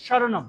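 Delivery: স্বরনাম said "shoronom", with sh; palatal sh. Shown as a demonstration. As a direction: start স্বরনাম with tongue near the palate. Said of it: "একটু touch করতেছে". (স্বরনাম-এর শুরুতে তালব্য শ)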